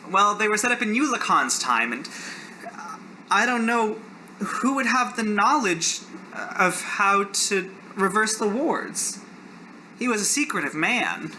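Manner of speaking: Keon. Acted. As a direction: speak up high as a troubled magic user. GM voices Keon.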